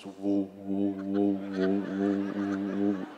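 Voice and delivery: low wobbling buzz of a massager; imitated buzzing massager. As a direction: hum it deep like a pulsing gadget.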